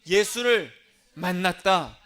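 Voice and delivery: loud, slow proclamation; emphatic confession. Acted. Preacher voicing believer's confession of meeting Jesus, emphatic proclamation. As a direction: proclaim it forcefully, word by word. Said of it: "뭐라고 고백하게 돼요".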